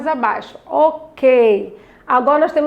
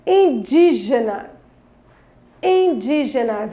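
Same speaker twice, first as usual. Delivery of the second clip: INDÍGENAS slowed, DÍ stressed; syllable stress demo; slower than usual. Shown as a demonstration. Teacher shows children the tonic syllable, Indígenas slowly stressed on DÍ. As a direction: stretch Indígenas and lean on DÍ. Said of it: "ler com maior intensidade a palavra".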